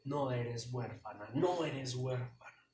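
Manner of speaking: a hint of God speaking gently. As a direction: speak as a tender heavenly father. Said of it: "Y el Señor te dice".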